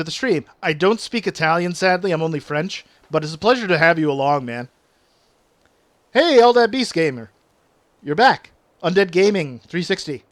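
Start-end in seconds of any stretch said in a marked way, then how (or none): none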